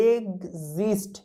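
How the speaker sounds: এক্সিস্ট stretched out slowly, egzist, with soft g-z; slow careful demo. Shown as a demonstration. Teacher slowly spells out এক্সিস্ট to students, softly. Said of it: "আর একটা শব্দ আছে যে এক্সিস্ট".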